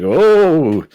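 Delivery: exaggerated sarcastic awe. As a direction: play along like an adult humoring a kid. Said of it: "like, sarcastically, I guess".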